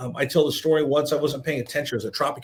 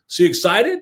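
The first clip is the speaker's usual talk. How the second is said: louder, higher questioning call; retold probing question; 5 semitones higher, 6 dB louder than usual. Manager calls over to the waiting reporter, probing question. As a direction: pitch it up and project the question.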